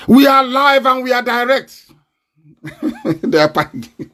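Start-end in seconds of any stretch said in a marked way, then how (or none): 2.6-4.2 laughing through the words. Real, not acted. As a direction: chuckle out a mocking jab. Mocking enemies, laughing.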